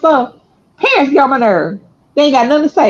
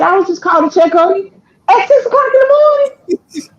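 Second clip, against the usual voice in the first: high-pitched imitation of grandmother's defence; mock wounded protest; 8 semitones higher than usual. Grandmother explaining she called to check, mock wounded protest. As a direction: mimic her, pitched way up.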